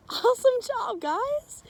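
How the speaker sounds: high, loud cheer; excited praise. Real, not acted. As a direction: gush with delight. Praising children after singing.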